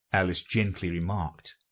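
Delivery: usual speaking voice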